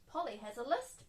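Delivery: plain reading voice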